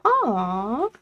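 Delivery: sweet thanks. Real, not acted.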